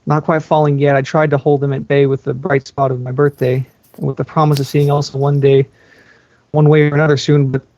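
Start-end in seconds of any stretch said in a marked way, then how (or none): none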